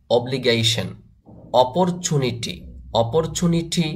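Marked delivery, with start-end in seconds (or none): none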